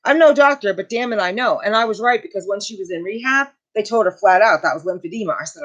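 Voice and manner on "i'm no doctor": usual speaking voice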